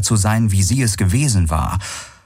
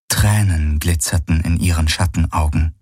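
usual speaking voice

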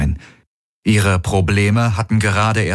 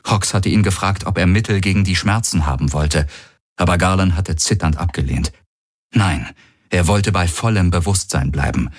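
plain reading voice

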